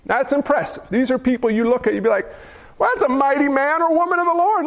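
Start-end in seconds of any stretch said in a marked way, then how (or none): 2.8-4.7 higher, quicker mock admiration; acted-out awe, pitched up and hurried; admiring onlooker. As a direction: marvel like an impressed churchgoer. Acting out admiring onlookers of church folks.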